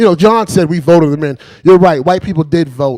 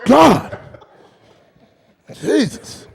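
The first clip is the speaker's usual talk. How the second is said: high, drawn-apart mock dismay; stunned exclamations; 8 semitones higher, much slower than usual. Obama reeling from secrets he has seen, mock dismay. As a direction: exclaim each word on its own, aghast.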